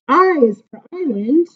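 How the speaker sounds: plain reading voice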